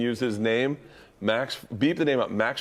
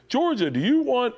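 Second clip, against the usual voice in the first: high, hammed-up Santa offer; imitating Santa; 13 semitones higher than usual. Uncle as Santa asks about her present, pitched high and playful. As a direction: sing out the question to a child. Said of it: "he'd be a perfect Santa voice".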